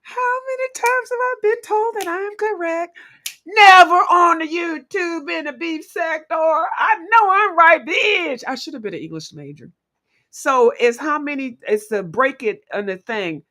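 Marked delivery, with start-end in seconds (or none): 0.0-8.4 high, loud, gloating celebration; triumphant crowing. Real, not acted. Host celebrating being told she is correct, triumphant crowing. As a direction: crow it out, drawing out the words.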